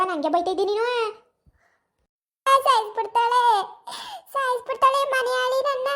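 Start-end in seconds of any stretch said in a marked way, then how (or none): 2.5-5.9 frightened wail. Acted. Beaten woman cries out to the neighbours that the housewife will kill her, high and wailing. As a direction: shriek that she is going to kill you.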